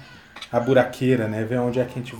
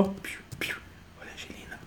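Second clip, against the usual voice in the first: whisper; 7 semitones higher, 16 dB quieter, faster than usual. Real, not acted. Dad whispers to baby while gaming.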